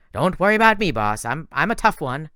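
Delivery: tough-guy horse. Acted. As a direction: insist you're fine. Blackjack reassures.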